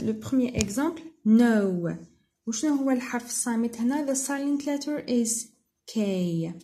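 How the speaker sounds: ordinary delivery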